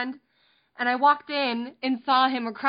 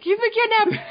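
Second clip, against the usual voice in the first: mock-alarmed, higher and louder; retold panic; 9 semitones higher, 5 dB louder than usual. Parent imagining a missing child, in mock panic. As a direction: play up a parent's worst fear.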